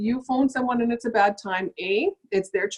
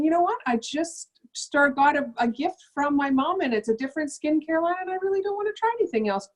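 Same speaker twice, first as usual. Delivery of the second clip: customer excuse; 6 semitones higher than usual. Retold or imitated. Customer declining skincare.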